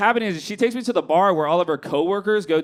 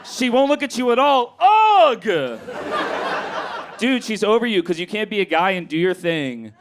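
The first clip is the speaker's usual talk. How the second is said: frantic note read; 3 semitones higher than usual. Shown as a demonstration. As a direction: read the panicked note to yourself. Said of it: "I'm gonna read you guys what I wrote".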